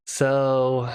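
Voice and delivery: usual speaking voice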